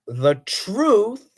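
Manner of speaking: a real crack slipping out mid-quote. Real, not acted. Reading scripture aloud to viewers, voice cracks. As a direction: voice cracks up high on the word. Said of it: "oh, excuse me, my voice cracked".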